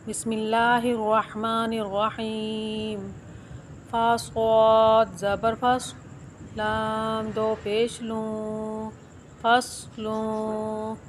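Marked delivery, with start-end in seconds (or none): 3.9-11.0 syllable by syllable, very slow; slow spelled-out recitation. Shown as a demonstration. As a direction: spell out each letter then join the sound. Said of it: "आपको हर अल्फाज के सही तरीका पढ़ने का पता चल जाएगा".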